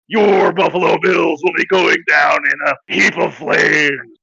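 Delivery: over-the-top wrestling hype voice; wrestling promo. Acted. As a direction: cut a promo like a pro wrestler, brother.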